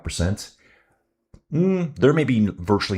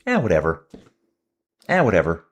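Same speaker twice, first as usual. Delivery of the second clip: imitating officials who can't be bothered; mock dismissive organizers; 3 dB louder, faster than usual. Race organizers shrug off a canceled stage, mock dismissive. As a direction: brush it off with a falling "eh, whatever". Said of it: "the organizers just say".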